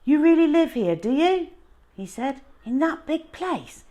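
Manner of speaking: high, loud astonished question; amazed boy. A narrator's reading. Runaway boy amazed at the narrator's huge home. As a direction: gasp at the size of the house.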